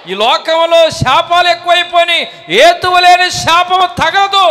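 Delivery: high, loud fiery sermon; preaching at full force. Real, not acted. Preacher thundering to the congregation about Balaam, full force.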